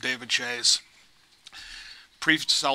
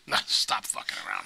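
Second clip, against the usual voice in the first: whisper; 6 semitones lower, 5 dB quieter than usual. Retold or imitated. Pleading with the boss over the phone.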